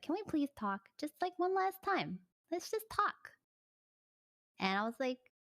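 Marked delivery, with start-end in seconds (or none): 0.0-3.3 mock pleading, pitch creeping up. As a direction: voice climbing higher into a whiny plea. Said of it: "And he's like".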